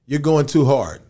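retold interruption. Director cutting off his take.

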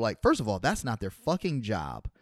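usual speaking voice

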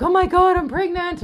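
high and loud burst of mock excitement; imitated giddy excitement; excited squeal. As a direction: rush it out as if sure she's pregnant. Thinks she is pregnant.

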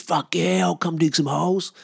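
high-pitched imitation of a gung-ho mate; volunteer with acted, over-keen excitement; hyped-up buddy voice; eager mate. Mate eagerly offers to dig.

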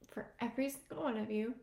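ordinary talk

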